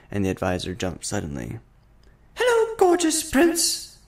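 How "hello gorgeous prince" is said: very high, loud, slower echoing call. A narrator's reading. The castle hall echoes the shout back. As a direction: let it ring back like a hall echo. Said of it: "the castle echoed loudly back".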